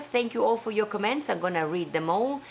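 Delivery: ordinary delivery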